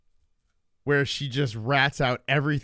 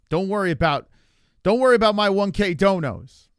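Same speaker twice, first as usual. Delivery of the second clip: loud, higher-pitched indignant comeback; defensive and loud, voice raised; indignant retort; 6 semitones higher, 6 dB louder than usual. Real, not acted. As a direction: push back loudly at a heckler, pitch jumping up. Snapping back at a chat jab.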